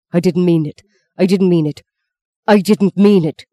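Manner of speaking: building from urgent to loud and insistent; distraught retraction. A narrator's reading. Horrified father takes back words, building.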